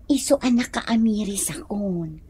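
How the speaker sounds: whisper. Acted. Wife confides softly to husband.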